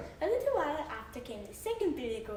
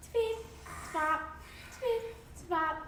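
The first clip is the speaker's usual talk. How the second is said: light tripping sound; 6 semitones higher, slower than usual. A narrator's reading. Bridge sound effect, small goat.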